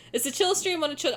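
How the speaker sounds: usual speaking voice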